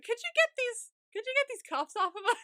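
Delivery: high-pitched, hammed-up polite plea; mock-sweet request. Handcuffed pair ask the guards, mock-sweet request. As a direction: ask it way up high, playing innocent.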